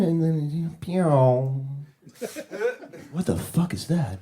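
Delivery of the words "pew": drawn-out falling "pew"; low, lazy imitated laser zap; lazy laser sound. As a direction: let the laser droop slowly downward. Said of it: "that's the sound of a lazy laser".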